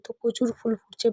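ordinary talk

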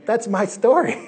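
laughing loudly as he says it. Real, not acted. Preacher laughing at his own story, loud and amused. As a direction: laugh it out, tickled.

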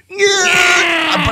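shrill yelled yeah; high cry. Acted. Mock triumphant cry.

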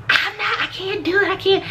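high, mock-panicked refusal; nervous girl. Girl refusing the pep talk, high mock panic. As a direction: squeal it like someone too scared to go in. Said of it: "I'm just so nervous".

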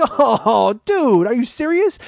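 loud, high, drawn-out exasperation; exasperated disbelief. Retold or imitated. Exasperated reaction to ride mix-up.

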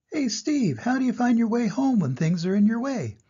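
high, eager voice; curious animal. Acted. Animal asks Steve about finding home, high. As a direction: call high and ask. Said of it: "another animal asks".

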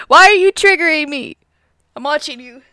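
ordinary talk

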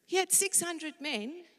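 ordinary talk, nothing marked

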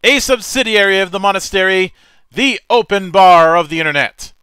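loud, high, grand announcer opening; show host intro. Acted. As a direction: welcome the audience like a showman host.

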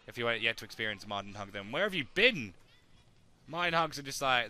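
ordinary delivery

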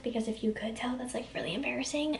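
embarrassed confiding whisper. Real, not acted. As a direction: whisper it like a guilty secret. Confessing wearing same outfit.

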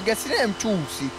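usual speaking voice

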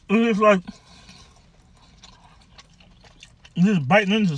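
chewing between words; talking while eating pizza; mouth full of pizza. Real, not acted. Seen 1: Holds pizza, bites at 4.2-6.1, then chews while talking.

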